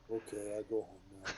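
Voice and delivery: soft mock Saudi accent. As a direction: quietly imitate the Saudi pilot giving up. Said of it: "In a Saudi voice".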